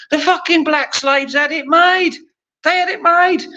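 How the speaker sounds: high-pitched indignant outburst; heated rant. Real, not acted. Comic ranting about who had it made, high indignant outburst. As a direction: exclaim it way up high, outraged.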